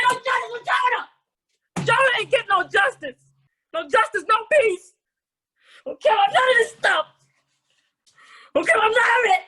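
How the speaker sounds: high, loud comic protest; mock outrage. Host demanding justice for Jonah, mock-outraged shouting. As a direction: rant like a hammed-up protester.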